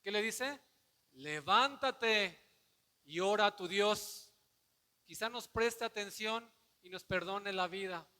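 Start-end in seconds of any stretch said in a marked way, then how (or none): 1.1-8.1 loud, drawn-out shouted order; captain yelling. Retold or imitated. Captain ordering Jonás to pray, loud shouted command. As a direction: yell the command over the storm. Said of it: "Le está gritando".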